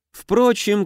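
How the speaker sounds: high, dismissive sphinx aside; bored, scornful sphinx. A narrator's reading. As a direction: toss it off with lofty scorn. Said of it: "скучающий и даже пренебрежительный голос". (скучающее «Впрочем» сфинкса)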